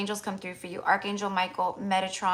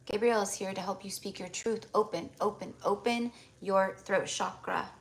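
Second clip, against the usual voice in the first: channelled healing blessing; 2 semitones lower, 9 dB quieter than usual. Acted. Healer clearing a client's energy, soft meditative blessing. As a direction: murmur the invocations gently, breathing out between them.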